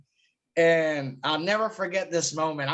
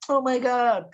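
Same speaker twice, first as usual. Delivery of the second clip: gushing fanboy; 6 semitones higher than usual. Retold or imitated. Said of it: "than being like".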